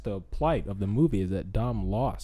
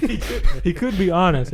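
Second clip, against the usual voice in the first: laughing; 9 semitones higher, 9 dB louder than usual. Real, not acted.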